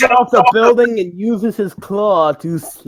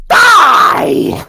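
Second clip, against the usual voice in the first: sudden high yelled "Die!"; mock battle cry; 7 semitones higher, 5 dB louder than usual. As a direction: bark it out like a cartoon villain. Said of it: "it's like".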